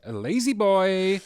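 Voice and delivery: high, drawn-out mock call; silly high voice. Repeats the joke in silly voice.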